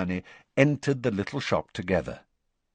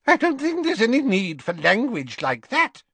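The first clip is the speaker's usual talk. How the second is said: high, prim dressmaker scolding; flustered shopkeeper rebuking a rude boy; disapproving Madame Malkin; 4 dB louder than usual. A narrator's reading. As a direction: tut at him like a flustered seamstress. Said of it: "said Madame Malkin".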